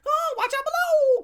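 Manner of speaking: high, loud playful shout; high excited yell; kid calling out. Retold or imitated. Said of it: "climbing on the monkey bars".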